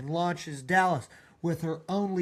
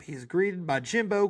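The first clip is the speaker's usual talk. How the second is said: normal talking voice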